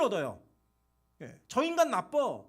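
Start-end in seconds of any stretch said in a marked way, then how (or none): 1.5-2.4 loud mocking politician attacking a rival; politician's smear. Politician slamming a rival to voters, loud and mocking. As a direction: hammer the opponent like a campaign speech. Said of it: "말하면서".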